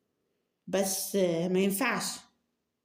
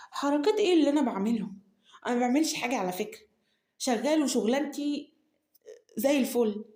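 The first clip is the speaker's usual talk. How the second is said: indignant protest; 4 semitones higher, 3 dB louder than usual. Acted. Nour indignant at accusation.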